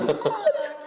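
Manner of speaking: very high, laughing squeak of disbelief; stunned by the winnings; shocked squeal. Retold or imitated. Dad seeing five hundred bucks on the phone, squealing in shock.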